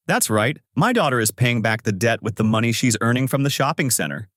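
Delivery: plain reading voice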